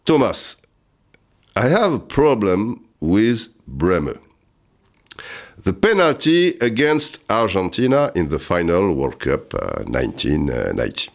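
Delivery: put-on slow, careful French-accented English; broken French-accented English. To his German friend on the phone, slow French-accented English. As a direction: spell it out in schoolboy English with a thick French accent. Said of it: "avec mon anglais à deux balles".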